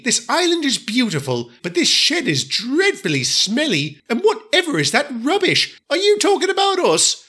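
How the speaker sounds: high, disdainful, sniffy; haughty diesel lady. Acted. Daisy complains about the smelly shed, high and sniffy. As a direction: turn up your nose at the shed.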